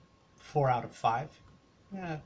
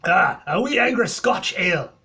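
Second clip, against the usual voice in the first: Scottish impression; 6 semitones higher, 13 dB louder than usual. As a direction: bellow it like a proud Scotsman in a bad Scottish accent. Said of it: "my horrible, horrible impression of a Scotch, Scotsman".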